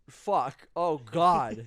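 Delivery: loud frustrated outburst; loud exclamation. Retold or imitated. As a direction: blurt it out loudly. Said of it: "I was like".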